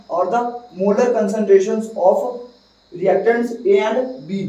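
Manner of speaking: slow dictation pace; dictating. Shown as a demonstration. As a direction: spell it out slowly for notes. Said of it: "क्या लिखना है?".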